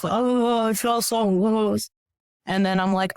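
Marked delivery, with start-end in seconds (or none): 0.1-1.9 higher, slow, lightly imitating her own tears; mock sobbing. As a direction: replay the lost, tearful moment, half-acted. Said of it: "like, sobbing on this rock".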